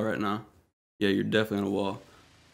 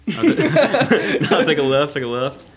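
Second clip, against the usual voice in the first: laughing through the words; giggling out directions; 5 semitones higher, 8 dB louder, much slower than usual. Real, not acted. Laughing while coaching blindfolded friend.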